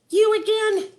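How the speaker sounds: squeaky surprised Wart. Acted. Wart spots the snake again, high and loud. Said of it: "he said".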